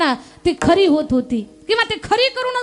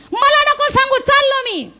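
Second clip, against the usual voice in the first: high-pitched hammed-up brush-off; angry old man; 7 semitones higher than usual. Old man dismisses wife's pleas, in a high huff. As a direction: cut off all pleading, acted fury up high.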